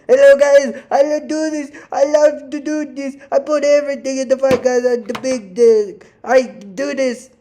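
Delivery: put-on high, loud Georgian-accented fighter voice, broken English; imitating Merab on the mic in a Georgian accent; mock Georgian accent. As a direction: ham up a Georgian fighter hyping the crowd. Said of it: "getting on the mic and going".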